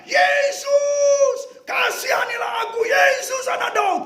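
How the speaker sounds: blind man ignoring the crowd, crying out to Jesus; desperate shout. Acted. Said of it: "dia berteriak berkata".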